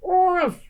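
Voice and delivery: oof with a high grunt; high sleepy oof. A narrator's reading. Bear shuffling off into the night, grunting. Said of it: "he growled".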